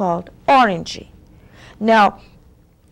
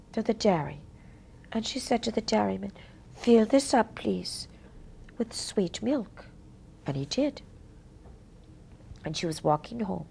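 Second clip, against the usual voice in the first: softer, whispery tale-telling; quiet bedtime-story narration; hushed storytelling; 12 dB quieter than usual. A narrator's reading.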